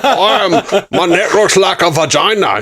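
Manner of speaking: high, comic Scottish character voice; Fat Bastard. Acted. Quoting a film character to a co-host, mock Scottish. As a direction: play a crude Scottish brute for laughs. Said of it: "didn't wanna just do fat bastard".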